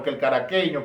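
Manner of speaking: usual speaking voice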